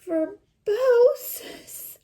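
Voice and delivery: put-on high, loud, puzzled child; little girl voice. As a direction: squeak it out like a confused little girl. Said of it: "talk and sound like her".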